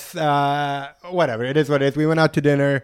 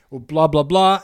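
ordinary talk

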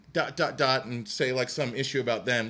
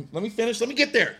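higher and louder, holding the floor; insisting to finish; insistent; 10 semitones higher, 5 dB louder, faster than usual. Real, not acted. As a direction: push back to finish the point. Friend interrupts him.

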